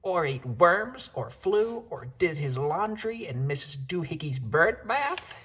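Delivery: high, slow scolding mother voice; scolding mama. Acted. Mother cat listing her son's odd habits, high scolding voice. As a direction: keep listing his crimes, exasperated.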